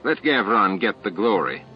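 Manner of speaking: plain reading voice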